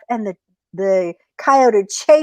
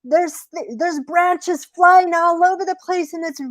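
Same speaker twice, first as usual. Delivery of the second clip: high, plaintive coyote voice; storybook coyote whimpering about the storm; hurt coyote; 9 semitones higher than usual. Acted. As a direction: complain as if being battered.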